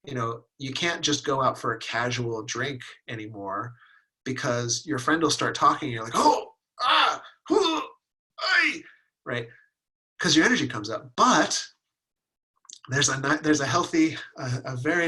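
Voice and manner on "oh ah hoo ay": high overwhelmed oohs and ahs; mock overwhelmed gasps. Someone swamped by a friend's emotions over drinks, in high mock gasps. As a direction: gasp like feelings are hitting you.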